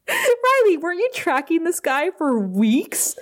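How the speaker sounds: high, incredulous mock scolding; exasperated disbelief. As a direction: sputter in mock disbelief at an idiot.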